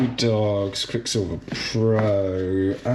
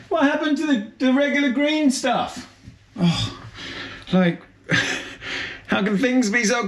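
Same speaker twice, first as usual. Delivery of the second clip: baffled; 13 semitones higher than usual. Real, not acted. Missing his usual toothpaste.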